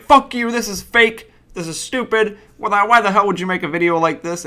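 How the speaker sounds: hammed-up angry commenter voice; mock raging commenter. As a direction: mimic a furious commenter losing it. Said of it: "a whole lot of rage of people being like".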